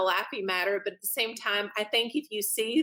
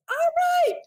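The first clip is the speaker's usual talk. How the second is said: much higher, louder drawn-out "all right"; amused surprise; 5 dB louder than usual. Retold or imitated. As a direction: accept the title with a laugh. Reacting to being called royalty.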